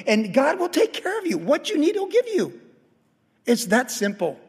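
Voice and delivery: high, loud pulpit preaching that keeps climbing; fervent preaching. Acted. Pastor exhorting his congregation to give, in full fervent preaching. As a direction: pound the message home from the pulpit.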